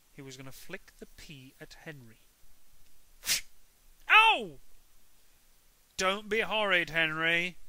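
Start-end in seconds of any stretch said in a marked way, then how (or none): 4.1-4.6 sudden high shout of pain; Henry yelping when a pea hits him; loud yelp of pain. A narrator's reading. 6.0-7.6 higher and much louder telling-off; scolding mum. A narrator's reading.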